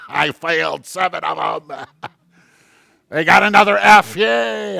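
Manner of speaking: laughing, a touch high, mock gleeful teacher; mock gloating teacher. Teacher gloating over failed kids, mock glee. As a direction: gloat playfully like a cartoon teacher. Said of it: "teachers sit around going".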